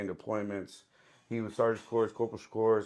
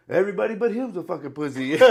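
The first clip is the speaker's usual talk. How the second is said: raised, louder imitation of the instructor; loud mock declaration; 8 semitones higher, 7 dB louder than usual. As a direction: deliver the punchline big and high. Retold comic punchline insult to room.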